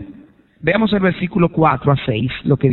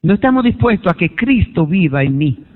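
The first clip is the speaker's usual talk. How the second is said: ordinary talk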